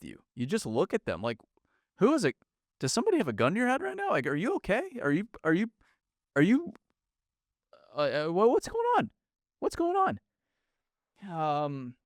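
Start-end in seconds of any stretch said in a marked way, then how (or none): 2.0-10.2 high, incredulous mock concern; mock baffled bystander. Podcaster mocking stiff corporate writers, high and incredulous. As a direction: squeak out a baffled are-you-okay.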